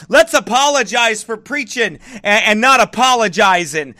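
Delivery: ordinary talk, nothing marked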